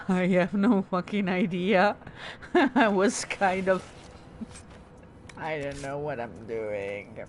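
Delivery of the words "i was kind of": ordinary talk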